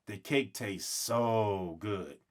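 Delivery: plain reading voice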